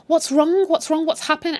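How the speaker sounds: high, urgent questions; mock alarm. Joyce runs in at the hospital, mock alarm. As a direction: imitate someone rushing in worried.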